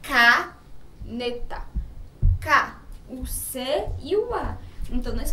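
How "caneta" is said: "Caneta" very slow and loud, opening ca held; syllable by syllable. Shown as a demonstration. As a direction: split "Caneta" into ca, ne, ta, hitting ca. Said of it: "Com a sílaba C-A". ("Caneta" bem devagar e forte, separando as sílabas)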